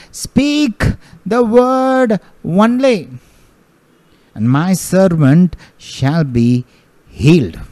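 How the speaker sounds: climactic preaching. Acted. Preacher voicing the centurion's plea, soaring. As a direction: lift "Speak" high and draw the line out.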